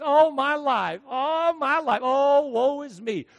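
high, loud whiny wail of self-pity; a hammed-up woe-is-me wail; mock lament. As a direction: ham up the poor-me moan. Mocking a self-pitying believer.

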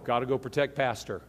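lightly voiced robot, low and soft; a hint of robotic monotone. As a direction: imitate a robot on a mission. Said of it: "it's just like a, a robot".

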